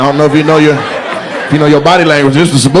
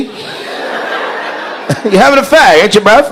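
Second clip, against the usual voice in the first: lightly mimicked British accent, pitched up; higher-pitched mock British voice; British bloke; 5 semitones higher than usual. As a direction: imitate a cheeky Brit asking a mate. Acts out a British smoker.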